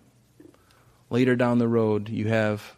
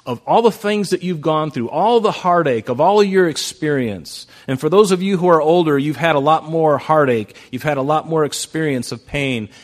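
higher, louder impassioned preaching; rising pulpit fervor, higher and louder; fervent preaching; 5 semitones higher, 8 dB louder than usual. Acted. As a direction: preach it to the older ones with fire. Preaching at full force.

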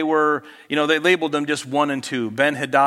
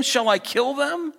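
repeats it higher and slower, pressing; eager king; 10 semitones higher, slower than usual. Acted. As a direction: savour the question, drawn out and high.

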